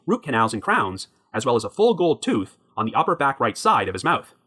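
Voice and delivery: ordinary delivery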